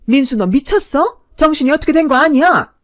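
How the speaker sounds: mother's loud, high outburst; high-pitched shriek. A narrator's reading. Mother appalled at her son's choice of woman, shrieking. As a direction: yell at the son in a high tone. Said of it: "하이톤으로 소리를 꽥 질렀어요".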